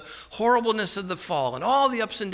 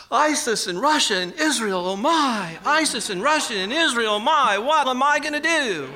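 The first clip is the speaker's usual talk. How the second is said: mock panic; 5 semitones higher, 3 dB louder than usual. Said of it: "we sometimes picture God going".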